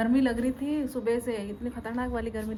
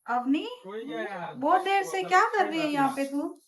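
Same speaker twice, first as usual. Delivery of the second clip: raised, questioning call; 6 semitones higher, 5 dB louder than usual. Real, not acted. Mother calls to the child.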